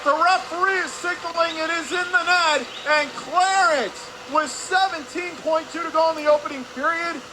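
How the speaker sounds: high, loud shout, holding its pitch through the goal; excited goal call. Real, not acted. Announcer calling a last-seconds goal to listeners, excited shout. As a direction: belt out the breakthrough goal at full voice.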